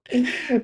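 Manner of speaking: high, bright burst of laughter; a startled laugh; sudden laugh. Real, not acted. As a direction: laugh breaks out at the chart.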